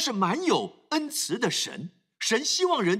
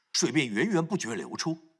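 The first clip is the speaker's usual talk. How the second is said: ordinary talk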